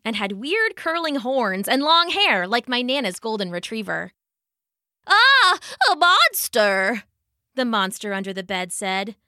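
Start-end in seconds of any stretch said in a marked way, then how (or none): 5.1-7.0 high, loud startled cry; startled scream. Acted. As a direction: yelp in fright. Monster startled by another monster.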